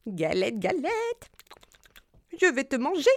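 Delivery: rabbit voice. Acted. Rabbit threatens the galette.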